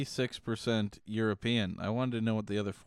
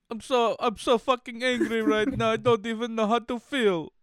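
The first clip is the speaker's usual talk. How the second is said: much higher, louder mock-angry Jamaican voice; mock Jamaican accent; 11 semitones higher, 4 dB louder than usual. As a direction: fake fury in a put-on accent. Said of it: "doing a Jamaican accent".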